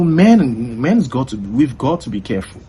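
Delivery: ordinary delivery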